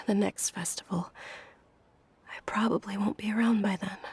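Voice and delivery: barely voiced, resigned to dying soon; sad whisper. Real, not acted. Frail girl facing her death, sad whisper. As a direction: whisper it like a secret she can't say aloud. Dunyarzad fears she will die.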